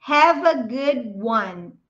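"Have a good one" slow, every word linked into one; linked-up English. Shown as a demonstration. Teacher slowly showing students the linked phrase. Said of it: "Vê a junção aqui".

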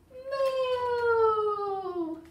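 very long, high, gliding moo; triumphant drawn-out baby moo; baby's moo. Acted. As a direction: stretch the moo right out for the little ones. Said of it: "Baby says".

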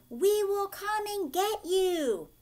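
high, loud and slow call across the water; shouting. Acted. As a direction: shout it out to stop them moving. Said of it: "shouted the citizens".

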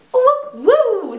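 high-pitched double whoop; weird woo. Real, not acted. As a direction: let out a silly, high "woo, woo". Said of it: "that was so weird".

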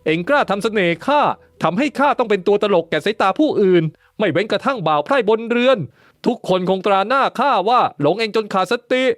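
high-pitched accusing fury; high angry voice. A narrator's reading. Lord accusing his concubine of bewitching him, high and furious. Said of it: "ถามเสียงสูง ดวงตาวาววับบอกถึงโทสะ".

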